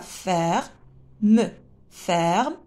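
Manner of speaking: "Ferme" broken into fer, pause, me; split into syllables. Shown as a demonstration. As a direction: sound out the final e of "Ferme" as its own beat. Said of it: "On a deux syllabes". (« Ferme » découpé, fer-me)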